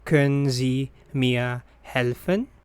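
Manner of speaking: usual speaking voice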